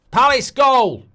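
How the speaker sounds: sudden loud, high-pitched shout; goal shout. Real, not acted. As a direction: yell out the goal as it goes in. Calling out a live goal.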